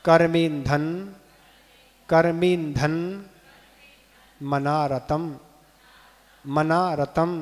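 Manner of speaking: each word said separately, measured and deliberate; pronunciation demo. Shown as a demonstration. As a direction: sound out the Sanskrit for pupils to repeat. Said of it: "इसका उच्चारण करें". (एक-एक शब्द का स्पष्ट उच्चारण)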